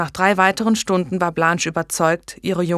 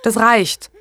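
firm, raised, loud cut-off; decisive; 5 semitones higher, 5 dB louder than usual. A narrator's reading. Blanche dismissing the old midwife, decisive. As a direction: put an end to it firmly. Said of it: "Dann traf sie eine Entscheidung".

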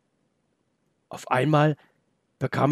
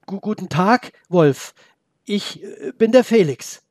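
put-on higher, halting boy's voice, stumbling over the words; a scared boy stammering, breath catching on the äh; nervous stammer; 6 semitones higher, 3 dB louder than usual. Boy nervously greets the talking wolf, stammering.

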